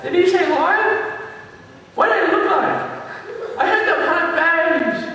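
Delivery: high-pitched comic questioning voice; curious wildebeest. Acted. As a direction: gossip in a high cartoon herd-animal voice. Rear wildebeest passes on rumour.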